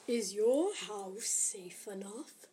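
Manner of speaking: slow, eerie whispered ad voice; creepy voice. Acted. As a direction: hiss it slowly like a spooky announcer. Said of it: "said the creepy voice".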